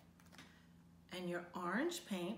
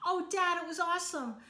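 higher and louder eager burst; excited cry; 8 semitones higher, 6 dB louder than usual. Acted. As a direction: gush to Dad about the day. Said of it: "Nemo cried".

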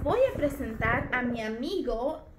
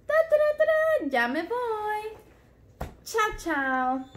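high chanting ta ta ta; march away like a toy soldier; puppet marching off; 6 semitones higher than usual. Pretend. Toy policeman marching off, chanting high.

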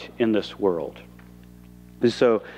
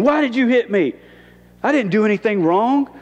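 mock indignant protest; 10 semitones higher than usual. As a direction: play the wronged man, pitched way up. Mocking an angry Jesus.

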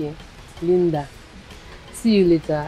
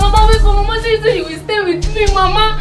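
high, loud sobbing cries of Mama; keening and begging her to wake up; wailing grief; 13 semitones higher, 5 dB louder than usual. Acted. Granddaughter wailing over her dead grandmother, crying for help.

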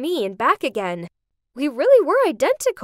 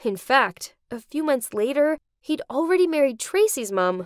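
usual speaking voice

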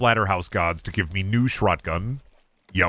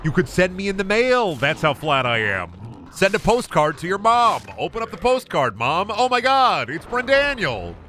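excited high voice; 7 semitones higher than usual. Pretend. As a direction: gush like a delighted mom. Acting mom opening postcard.

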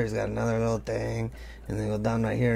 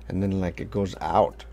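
ordinary talk, nothing marked

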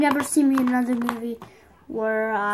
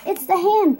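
high, loud, urgent warning; panicked warning; 8 semitones higher, 4 dB louder than usual. Acted. Lego figure warns the others that the hand is back, panicked. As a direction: sound the alarm.

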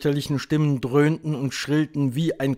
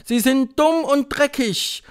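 high, loud, drawn-out echo; witches' chorus; 14 semitones higher, 7 dB louder, slower than usual. A narrator's reading. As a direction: chant it slowly back to the leader. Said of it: "antwortete der Chor der englischen Hexen". (Hexenchor, langsam und laut nachsprechend)

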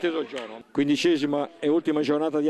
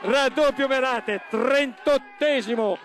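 breathless italian goal commentary; 7 semitones higher, slower than usual. Real, not acted. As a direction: yell the goal, stretch raddoppio slowly. Second goal called live.

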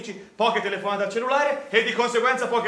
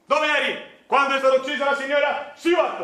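interrogation shout; 4 semitones higher than usual. Acted. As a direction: grill the witness, shouting. Mock courtroom interrogation.

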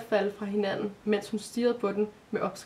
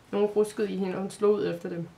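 plain reading voice

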